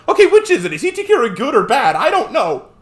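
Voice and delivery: high, loud, hammed-up bewilderment; mock exasperation. Replying to contradicting critics, loud high exasperation.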